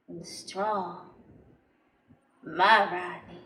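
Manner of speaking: low, halting, word by word; hushed adoration. Acted. Savouring Rodney's approach.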